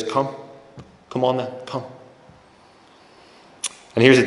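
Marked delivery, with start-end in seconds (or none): 0.0-1.8 gentle call. Retold or imitated. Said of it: "He says".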